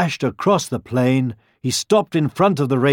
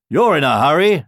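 higher, louder voice; boar character; 4 semitones higher, 5 dB louder than usual. A narrator's reading. Boars to the rushing deer and hares, calling. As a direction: remark with raised pitch and volume. Boars hail the fleeing animals.

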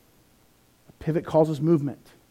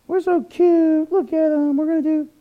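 doting parent; 9 semitones higher than usual. Retold or imitated. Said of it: "Nowadays we go".

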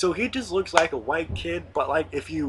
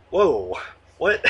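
surprised; 3 semitones higher, 7 dB louder than usual. Retold or imitated. Shocked reply to the teacher.

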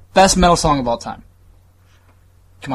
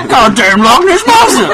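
loud, high, slow laughing yell; hammed-up outburst at girlfriend; mock shouted insult; 10 semitones higher, 10 dB louder, slower than usual. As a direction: blurt it out through laughter. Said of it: "you're like".

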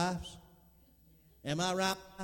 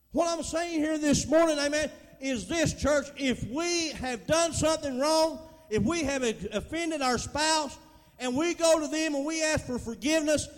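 preaching at full force; 4 semitones higher, 5 dB louder than usual. Acted. Pastor urging the church to forgive, preaching at full force. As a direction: hammer the point home to the church.

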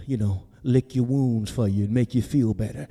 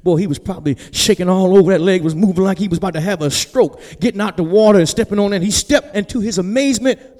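raised, louder storytelling from the pulpit; animated preaching; 5 semitones higher, 8 dB louder than usual. Acted. Preacher picturing Peter stepping out, animated preaching. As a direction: build the excitement, high and strong.

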